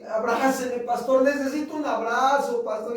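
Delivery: imitating a crying youth pleading; retold tearful plea. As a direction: ask for a hug like a weeping young man. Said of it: "y llora, y llora".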